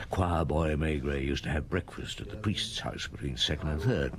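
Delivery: low, hushed thinking-aloud voice; inner monologue. Acted. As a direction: think it to yourself in the pew. Inner thoughts during the Mass.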